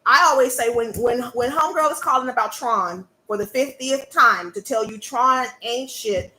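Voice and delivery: normal talking voice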